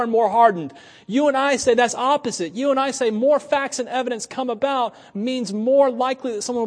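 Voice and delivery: preaching at full force. Acted. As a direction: press the point home to the congregation.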